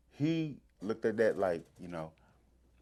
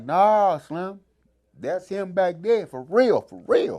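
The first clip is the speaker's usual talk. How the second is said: insistent; 8 semitones higher, 11 dB louder, much slower than usual. Retold or imitated. Insisting it's really him.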